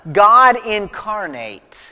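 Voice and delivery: ordinary delivery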